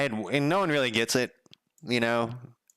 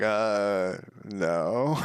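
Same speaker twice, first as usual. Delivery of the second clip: deadpan refusal. Retold or imitated. Speaker refuses hangout deadpan.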